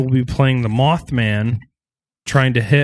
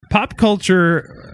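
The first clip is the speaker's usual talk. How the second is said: announcer tease; 8 semitones higher, much slower than usual. Acted. Announcing segment.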